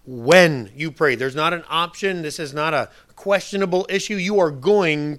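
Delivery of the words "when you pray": loud, slow punch on "When you pray"; very specific, emphatic. Retold or imitated. Preacher stressing that prayer is not an option, loudly emphatic. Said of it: "being very, very specific".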